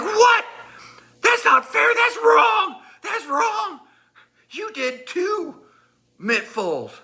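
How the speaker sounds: very high, squealed protest; hammed-up falsetto indignation; mock outrage. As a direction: imitate a wronged friend crying foul. Retold friend's outraged protest.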